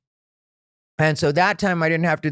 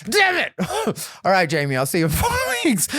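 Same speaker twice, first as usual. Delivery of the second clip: exasperated giving in; 5 semitones higher, faster than usual. Retold or imitated. Frustrated comic gives in.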